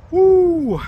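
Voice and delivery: high, loud joyful whoop; excited whoop. Real, not acted. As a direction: let out a big happy woo. Excited cheer after a work session.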